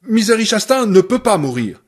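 loud furious cry, pitch up; shouting. Acted. Annie shouting at Paul in furious denial. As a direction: bellow it in disbelief. Annie raging over Misery's death.

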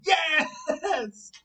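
loud high whoop of victory; triumphant cheer. Real, not acted. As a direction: cheer like you just finished the set. Finishing ten push-ups.

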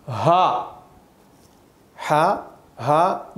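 हा from the throat, not a laughing ha. Shown as a demonstration. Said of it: "ये हा अदा होना चाहिए". (गले से हा)

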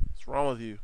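usual speaking voice

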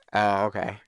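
ordinary delivery

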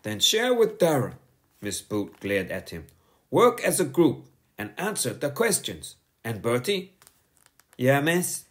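high, firm schoolteacher voice; a strict teacher scolding a pupil; stern teacher. A narrator's reading. Teacher warning Bertie to sit down, stern and high. Miss Boot's voice.

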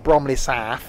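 Bromley Saff, South said as a long SAAF; put-on local pronunciation. Shown as a demonstration. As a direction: turn South into Saff, ending on F. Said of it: "you gotta pronounce it like that".

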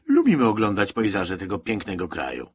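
loud opening, mock-appreciative sarcasm; ironic. A narrator's reading. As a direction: praise it with open irony. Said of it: "Michał nie mógł powstrzymać ironii".

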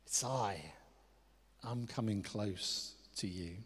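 low, soft and unhurried; tender reassurance. Acted. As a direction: gently let them know you are near. Voicing Jesus drawing near.

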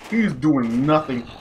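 ordinary talk, nothing marked

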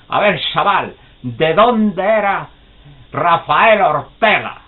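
high, loud, slow quizzing voice; drawn-out quiz. Retold or imitated. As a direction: stretch the question out to test the boy. Old man quizzing.

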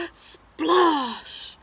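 gasp, then loud high splash; big splash sound. Acted. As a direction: burst out with a drawn-out splash. Acting out fall into pond.

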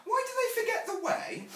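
put-on very high, loud little-girl question; questioning child. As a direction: demand an answer like a small child. Child Jane questions her mother.

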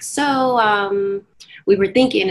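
high, hesitant lead-in, imitated; tentative broaching. Agency suggesting she drop her name, tentative. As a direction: imitate someone gingerly raising an awkward suggestion.

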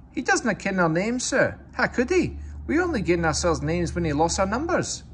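sly Scottish engine excuse; Scottish accent. A narrator's reading. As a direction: innocent Scottish twins to the boss. Scottish twins.

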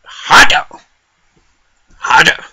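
loud, high fed-up outburst; fuming. Acted. Angry granny ranting about her cold, fuming shout. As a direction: shout it like you are fuming. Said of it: "So I'm fucking fuming".

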